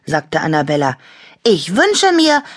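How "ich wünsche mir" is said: high, insistent little voice; high-pitched, emphatic announcement; eager wishing. A narrator's reading. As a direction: declare the wish with relish. Toy eagerly announces a wish.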